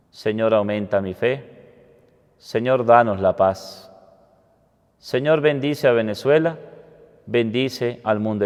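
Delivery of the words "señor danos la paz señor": normal talking voice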